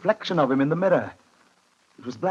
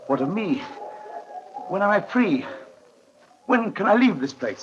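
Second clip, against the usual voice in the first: higher, pressing pleas; anxious pleading; 7 semitones higher than usual. Acted. Harker presses the Count to let him leave, anxious. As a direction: beg to know when you can go.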